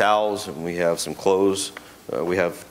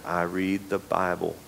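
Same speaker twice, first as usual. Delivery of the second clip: slower, softer, a hint of an old country lady; 2 semitones lower, 7 dB quieter, slower than usual. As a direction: lightly voice Hetty Lou, matter-of-fact. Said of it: "And she said".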